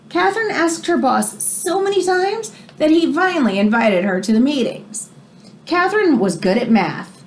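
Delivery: expressive read-aloud storybook voice; reading to children. Acted. Teacher reading a biography to her class, expressive read-aloud. Reads picture book to class.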